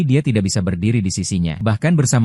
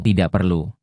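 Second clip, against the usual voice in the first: flat; 2 semitones lower than usual. A narrator's reading. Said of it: "Nada bicaraku menjadi lebih datar".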